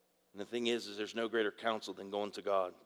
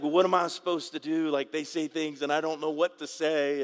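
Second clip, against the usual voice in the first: frustrated son; 5 semitones higher, 6 dB louder than usual. Retold or imitated. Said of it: "And Wade's like".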